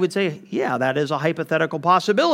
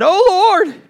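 1 anguished cry; 9 dB louder than usual. Acted. Jonah's angry prayer read aloud.

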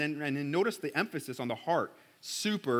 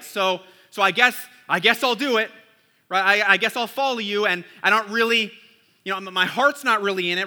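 mock grudging resignation, louder; reluctant shrug; 4 semitones higher, 7 dB louder than usual. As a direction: act out doing it because you have to. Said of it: "that rut of obedience".

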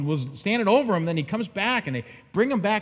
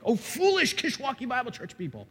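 lightly voiced, pitched-up mock rebuke; mock scolding letter; 6 semitones higher than usual. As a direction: pretend to scold the church, lightly and higher. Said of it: "could you imagine me gone somewhere and then I write you a letter back".

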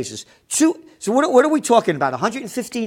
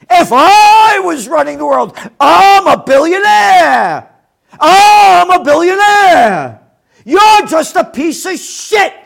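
much higher and louder, slow, puffed-up sneer; mocking billionaire; 9 semitones higher, 10 dB louder, slower than usual. Mocking the arrogant billionaire, he shouts in a hammed-up rich man's voice. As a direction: blow the quote up into a pompous, hammed-up tycoon.

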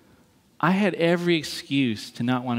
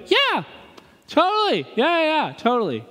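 squeaky, much higher, hammed-up yes-man; mock eager agreement; 12 semitones higher than usual. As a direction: pretend to agree while thinking no. Fake agreement retold, squeaky voice.

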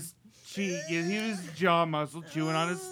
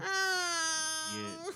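mock sob; 14 semitones higher than usual. Mock crying.

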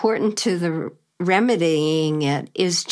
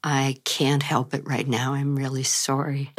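hoarse from a cold, low; husky sexy voice from a cold; 5 semitones lower than usual. Real, not acted. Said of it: "my sexy voice".

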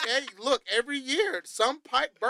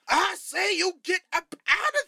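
high, loud preacher command; 11 semitones higher, 4 dB louder than usual. Retold or imitated. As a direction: preach it at the pipes. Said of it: "looked at the pipes like a preacher".

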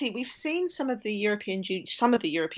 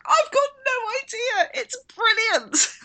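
high, slow, gleeful squeal; thrilled that nobody knows, almost laughing; giddy delight, voice high and slow; 14 semitones higher, 4 dB louder than usual. Retold or imitated. Delighted quoting of herself.

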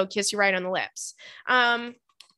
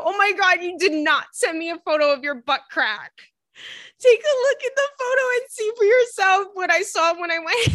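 much higher, excited and disbelieving; excited disbelief; 8 semitones higher than usual. Real, not acted. Reading a listener's embarrassing gym story, excited high disbelief. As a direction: squeal in shocked delight.

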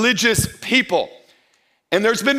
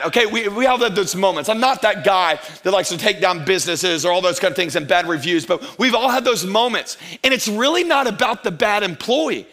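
normal talking voice

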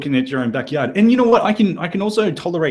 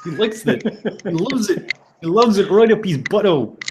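put-on Kiwi accent; 5 semitones higher than usual. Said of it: "I think I'm losing the accent now".

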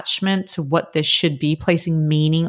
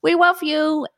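excited; 11 semitones higher than usual. Real, not acted. Signing off to listeners.

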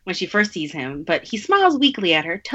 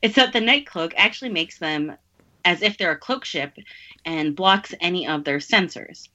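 ordinary talk, nothing marked

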